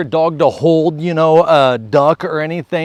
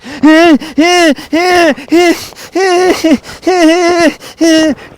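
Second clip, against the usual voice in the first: laughing; 12 semitones higher, 5 dB louder than usual. Real, not acted. Laughing during demo.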